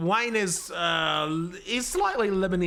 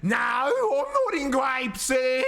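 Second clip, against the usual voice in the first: mock shout; 6 semitones higher, much slower than usual. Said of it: "echoing the mock quote louder".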